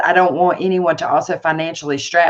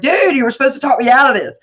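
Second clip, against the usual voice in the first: disbelieving protest; 7 semitones higher, 5 dB louder, faster than usual. Retold or imitated. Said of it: "And I was like".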